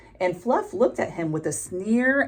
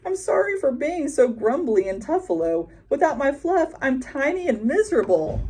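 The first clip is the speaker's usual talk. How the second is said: sorry buffalo; 7 semitones higher than usual. A narrator's reading. Buffalo apologises tearfully.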